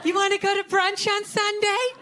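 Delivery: put-on lilting invite from a girlfriend. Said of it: "they'll be like".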